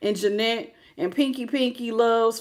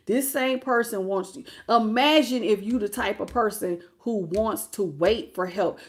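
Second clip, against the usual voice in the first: usual speaking voice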